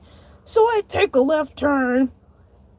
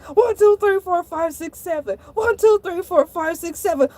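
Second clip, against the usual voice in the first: frantic counting. Acted. Shark trying to calm himself before the manager, frantic. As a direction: rattle off numbers in a panic.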